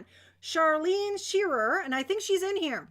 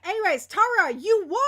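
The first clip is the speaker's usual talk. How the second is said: excited; 4 semitones higher, 7 dB louder than usual. Real, not acted. Announcing the winner.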